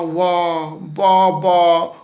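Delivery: put-on loud, high child's wail of wah and ba; crying wails. Shown as a demonstration. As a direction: wail it like a small child bawling. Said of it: "making a sounds like wah".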